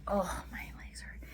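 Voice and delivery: breathy whispered complaint under the breath; whispered aside. Real, not acted. Vlogger muttering to herself about sore legs, whispered. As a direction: whisper it to yourself as the legs ache.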